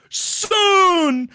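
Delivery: high, loud cry of relief; joyful cry. Acted. A father cries out to his waking son, overjoyed.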